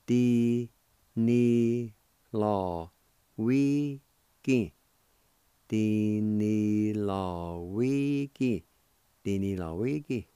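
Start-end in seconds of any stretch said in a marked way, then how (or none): 0.1-4.7 each syllable said apart; slow, one syllable at a time. Shown as a demonstration. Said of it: "it's pronounced like this". 5.7-8.6 slow, drawn-out Cherokee word; careful teacher demo of Di ni law wi ki. Shown as a demonstration. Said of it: "it's pronounced like this".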